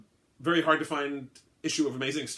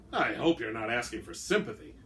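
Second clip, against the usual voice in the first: plain reading voice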